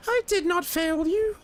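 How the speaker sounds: very high squeaky indignant voice; shrill high-pitched protest; Lareth high voice. Acted. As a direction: squeal like a wronged little lord. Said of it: "different voice for Lareth".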